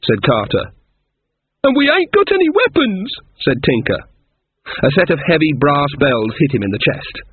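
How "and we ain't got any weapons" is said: shrill panicky pitch up high; panic, voice jumping up; high frightened whine. Acted. Scared Tinker whines.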